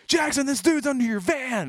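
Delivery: high, loud imitation of someone raising the alarm; imitating a frantic heads-up yell; urgent alarm shout. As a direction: shout the urgent news across the room. Someone runs in to alert him.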